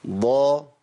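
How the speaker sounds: ضا heavy and full; emphatic Daad. Shown as a demonstration. Teacher models ضا for the class. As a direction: say ضا thick, not دا. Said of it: "آه، الضاد".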